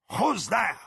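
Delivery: loud, high gruff snarl. A narrator's reading. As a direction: snarl it at the door. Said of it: "a gruff voice snarled from within".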